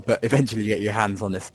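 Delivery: normal talking voice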